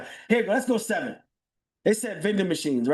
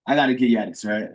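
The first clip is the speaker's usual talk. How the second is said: teasing reluctance; 8 semitones lower, 4 dB louder, faster than usual. Real, not acted. Host teasing his live audience with a sighing reveal. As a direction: sigh it out like you're giving away a secret.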